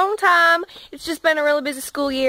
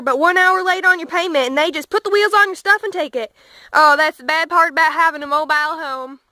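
put-on southern accent. Hillbilly farm kid joking to camera, light Southern accent. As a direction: play a deadpan Southern country boy, lightly. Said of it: "thank heavens I have my southern accent".

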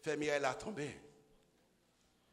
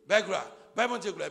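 loud, higher glory shout; praise cry; 5 semitones higher, 13 dB louder than usual. Acted. Wife praising God while waiting, a loud cry. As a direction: burst out in praise. Voicing praying wife's praise.

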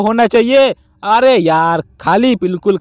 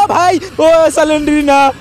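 high, drawn-out startled yelp; startled cry; 5 semitones higher, slower than usual. Real, not acted. Gamer startled by Slenderina popping up, startled cry. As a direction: cry out at a jump scare. Jump scare by Slenderina in game.

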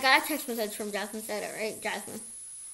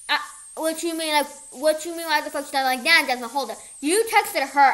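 louder, higher retold confrontation; indignant confrontation; 4 semitones higher, 6 dB louder than usual. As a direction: act out a boyfriend calling someone out. Boyfriend's retold angry confrontation.

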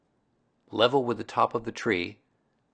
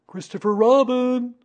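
high, loud, stretched call; Pooh, calling down; 14 semitones higher, 8 dB louder than usual. A narrator's reading. Pooh calling to the boy below, loud hail. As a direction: hail a friend from the treetop. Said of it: "he called down to you".

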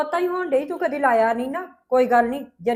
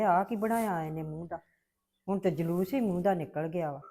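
much lower and softer grieving sigh; sorrowful lament; 7 semitones lower, 11 dB quieter than usual. Acted. Mother laments softly and lower over her daughter's ruined face. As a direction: mourn it quietly, heartbroken.

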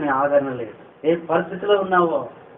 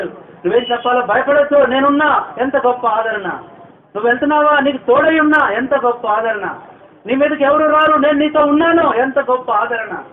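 loud, high, ringing preacher's cry; fiery revival preaching, higher and louder; full-force preaching; 7 semitones higher, 6 dB louder than usual. Acted. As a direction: proclaim it to the whole hall.

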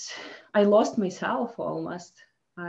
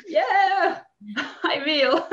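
a high, laughing and louder yes from dad; eager dad, laughing; 8 semitones higher, 5 dB louder, much slower than usual. Retold or imitated. Dad agreeing to coach his daughter, laughing high. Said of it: "And my dad was like".

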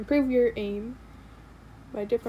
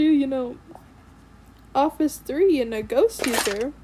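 ordinary talk

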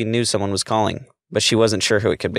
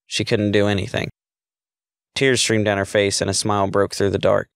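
ordinary delivery, nothing marked

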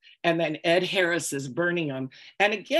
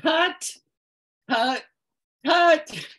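sharp, much higher call of cut; 11 semitones higher, 5 dB louder, much slower than usual. Retold or imitated. Director stopping the shot after the phone rang, calling loudly. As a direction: stop the take out loud. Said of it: "you hear the director go".

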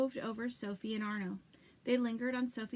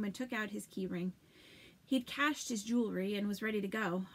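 ordinary delivery, nothing marked

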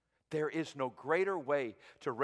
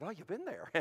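higher, quiet imitation of a British accent; 6 semitones higher, 7 dB quieter than usual. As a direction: mimic a British speaker lightly. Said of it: "answered in a British accent".

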